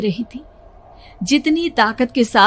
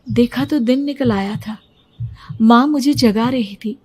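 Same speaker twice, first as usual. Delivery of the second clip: usual speaking voice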